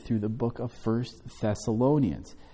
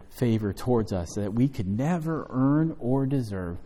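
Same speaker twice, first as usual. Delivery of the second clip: ordinary delivery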